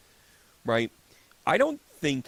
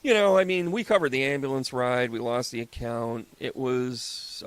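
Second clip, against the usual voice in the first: usual speaking voice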